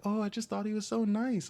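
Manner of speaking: high, airy mock of a clueless girl; mocking naive voice. Mocking the girl who called the abuser nice, airy. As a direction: parody her oblivious words. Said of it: "She was like".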